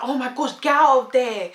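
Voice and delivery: high, loud alarmed urging; frantic older-sister warning; panicked sister. Retold or imitated.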